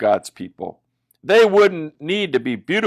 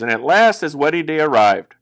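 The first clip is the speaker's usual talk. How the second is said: ordinary delivery, nothing marked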